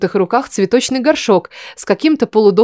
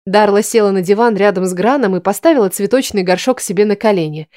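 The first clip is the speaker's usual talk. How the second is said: usual speaking voice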